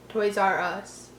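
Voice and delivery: ordinary delivery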